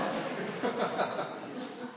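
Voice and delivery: breathy chuckle at the punchline; real laugh. Real, not acted. Preacher laughs to the congregation at the punchline, chuckling. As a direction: laugh at your own joke.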